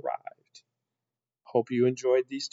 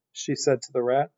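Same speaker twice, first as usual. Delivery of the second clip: ordinary delivery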